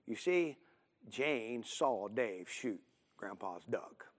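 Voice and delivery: plain reading voice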